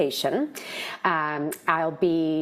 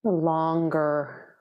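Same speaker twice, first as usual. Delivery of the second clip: guided meditation. Real, not acted. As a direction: ease them gently into stillness, leaving space between phrases.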